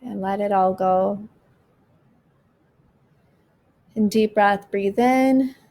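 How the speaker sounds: slow, calm breathing guide; guided meditation. Acted. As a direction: ease them through each breath.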